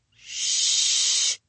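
unvoiced hushing shh; shushing. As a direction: hush the crowd. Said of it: "I muttered from the landing".

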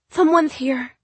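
put-on high child voice with a lisp. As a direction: lisp it like a sick little boy. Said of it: "he lisped".

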